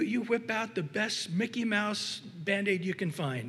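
usual speaking voice

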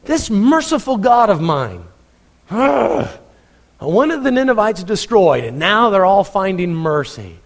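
a sulking prophet complaining; whiny upset Jonah. Acted. Jonah grumbling to God about mercy, high and whiny. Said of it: "He was upset".

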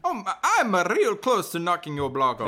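high, loud, exasperated warning; angry threat. Acted. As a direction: stammer into a heated threat, pitch jumping up. Annoyed character threatens the nosy questioner.

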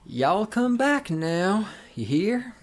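very slow, high Southern lilt, unprojected; leisurely Southern drawl, rising at each pause; slow, relaxed Southern accent. Shown as a demonstration. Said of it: "You gotta be a little slower, a little more relaxed".

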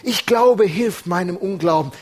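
preacher voicing the father's desperate cry to Jesus. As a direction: cry it out in need. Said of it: "dieser Schrei des Vaters".

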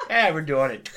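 pushy, laughing mock insistence; mock aggressive. Retelling a pushy stranger at a concert, mock aggressive. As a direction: bulldoze past any refusal, half-laughing. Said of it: "I love how aggressive she was".

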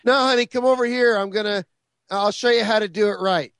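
high, loud, over-eager parental takeover; mock perfectionist parent. Parent calls child over to show her, mock helpful. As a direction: step in to correct the child.

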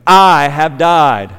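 slow, weighted "I" opening; stress on I. Shown as a demonstration. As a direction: punch "I" and slow down. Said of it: "That Greek word is ego".

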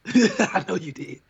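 chuckling through the words; laughing. Real, not acted. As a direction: laugh it out slowly. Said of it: "laughs, repeated".

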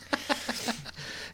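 high breathy giggle; high laugh. Real, not acted.

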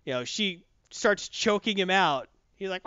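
ordinary delivery

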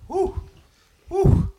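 high, loud whoop; excited whoop. Real, not acted. Reacting to a hot bite, loud high whoop. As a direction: let out a big spicy-food woo.

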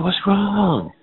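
sudden fright at the stairs; alarmed father. Retold or imitated. Father alarmed, thinks someone died.

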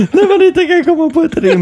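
high, giggling through the words; words squeezed out through giggles; laughing. Real, not acted. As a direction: laugh out the line. Group laughing at rhyme joke.

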